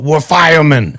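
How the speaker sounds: high, loud hype-man yell; fired-up motivational shout. Retold or imitated. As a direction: bellow it to pump up a fighter. Acting out motivational fight speech.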